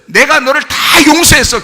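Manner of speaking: high, loud proclamation; emphatic declaration. Acted. God to sinner, declaring forgiveness, loud and emphatic. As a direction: proclaim it with full force.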